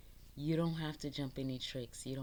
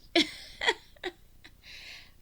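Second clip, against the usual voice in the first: high cackling laugh; 5 dB louder than usual. Real, not acted. Cackling at her own joke.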